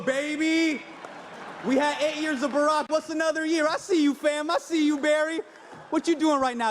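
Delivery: high-pitched, hyped-up shout-out to a pal; mock-chummy hollering, high all through; playful calling out. Calls out to absent former president.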